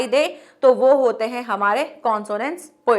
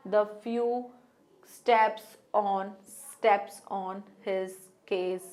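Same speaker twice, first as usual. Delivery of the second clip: slow, softer and lower poem recital; soft recitation; 6 semitones lower, 9 dB quieter, much slower than usual. Shown as a demonstration. Teacher reciting the poem aloud to students, soft and low. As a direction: murmur the lines low and slow, savouring them. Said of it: "बोल के देखते हैं".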